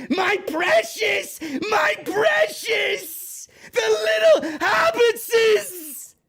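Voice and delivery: high, very slow, shouted creepy Gollum hiss; mocking the wrestler's promo as a shrieking Gollum; Gollum impression. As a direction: crawl out of the cave clutching the ring, very slowly. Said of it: "She sounded like fucking Schmeagol".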